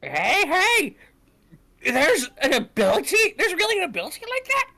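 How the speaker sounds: high, loud amazed exclamation; excited. Acted. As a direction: burst out in disbelief. Character amazed by the news.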